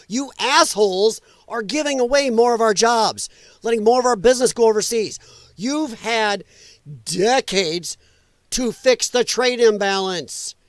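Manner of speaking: real exasperated rant, key words hit hard; angry rebuttal. Real, not acted. Commentator rebuking lawmakers over decades of inaction, angry.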